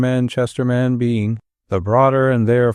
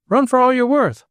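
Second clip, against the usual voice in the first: urgent call; 12 semitones higher than usual. A narrator's reading. Urging flight to train.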